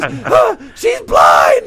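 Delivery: very high, loud squeal, mock breathless; squealed impression of a man laughing too hard to talk; imitating helpless laughter. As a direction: act out forcing words through hysterics. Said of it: "and he can't talk".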